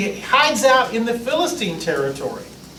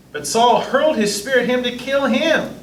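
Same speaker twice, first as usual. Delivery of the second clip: usual speaking voice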